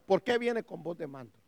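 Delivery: hushed afterthought question; dropped-down question. Real, not acted. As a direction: bring it down and let them ponder. Same question repeated much more quietly.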